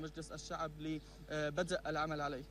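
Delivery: faint voice on a failing line; barely audible through the dropout; reentry blackout. Real, not acted. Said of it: "أن هناك خلل في الاتصال".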